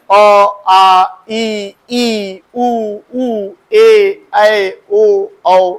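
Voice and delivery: each vowel said out clearly for the class; teacher drilling vowels. Shown as a demonstration. Teacher reciting Hindi vowels to students, clear and drawn out. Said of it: "तो हम लोग का सही उच्चारण यही है".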